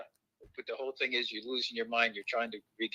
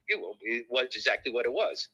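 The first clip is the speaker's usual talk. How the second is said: ordinary delivery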